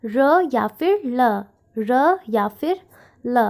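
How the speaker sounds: र and ल said slowly, one by one; slow letter demo. Shown as a demonstration. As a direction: spell out र or ल for learners. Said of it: "इसको हम pronounce करते हैं as". (र या ल को धीरे-धीरे बोलें)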